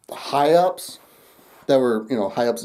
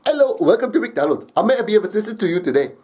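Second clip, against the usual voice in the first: higher, put-on Indian accent; 7 semitones higher, faster than usual. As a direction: greet like a call-center agent with an Indian accent. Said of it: "Indian accent".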